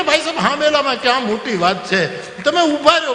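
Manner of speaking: high pleading call. Retold or imitated. Husband calls after guests to wait, high pleading. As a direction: beg them to stop, voice up high.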